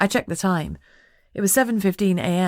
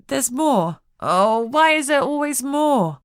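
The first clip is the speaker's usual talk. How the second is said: higher, slow exasperated groan; 8 semitones higher, 5 dB louder, slower than usual. A narrator's reading. Lucy groaning at Jess's news, slow exasperated. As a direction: whine it out, fed up. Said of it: "she groaned".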